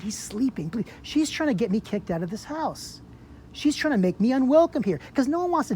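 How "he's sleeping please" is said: whispered hush; retold whisper. Begging the officer to hush by the sleeping roommate's door, whispered. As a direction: hush her so the sleeper stays asleep.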